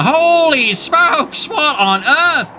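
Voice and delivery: high, slow stunned gasp of disbelief; shocked surprise. Real, not acted. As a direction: react as if the screen just exploded with enemies. Casting a sudden huge enemy blob.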